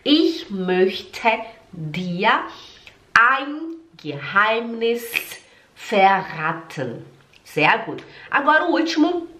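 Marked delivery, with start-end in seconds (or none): 0.0-7.0 word by word, long pauses between; slow model reading. Shown as a demonstration. Teacher speaks first, learners after, slow separated words. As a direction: stretch each word for learners to copy. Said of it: "eu vou falar, depois você fala".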